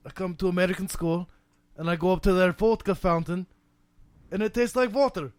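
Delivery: put-on drifting Russian accent. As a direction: tell it like a Russian student. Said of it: "you're bordering on Middle Eastern".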